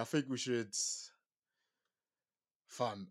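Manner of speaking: usual speaking voice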